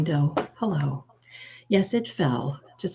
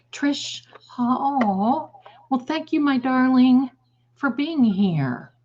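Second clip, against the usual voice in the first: much higher, slow gushing thanks; delighted thanks; 8 semitones higher, 3 dB louder, slower than usual. Real, not acted. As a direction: gush your thanks to Trish. Thanking a viewer on stream.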